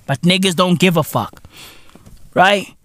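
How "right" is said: ordinary talk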